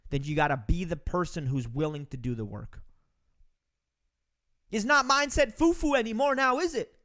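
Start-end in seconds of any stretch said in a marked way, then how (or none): none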